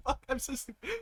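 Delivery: ordinary talk, nothing marked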